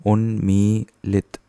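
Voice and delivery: उन्मीलित said slowly, syllable by syllable, un-mee-lit; careful model word. Shown as a demonstration. Teacher modelling उन्मीलित slowly for students, un-mee-lit. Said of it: "उन्मीलित is the right, right word".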